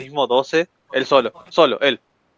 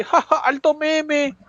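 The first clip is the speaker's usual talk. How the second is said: mock careless laugh; 8 semitones higher, 4 dB quieter, much slower than usual. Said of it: "La diosa ahí".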